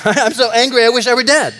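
preacher mocking furious Jonah; mock angry Jonah. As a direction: bluster like a sulking prophet. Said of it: "his response".

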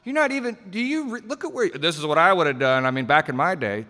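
put-on know-it-all old-timer. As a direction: needle the armchair expert with a mock lecture. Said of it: "It's like".

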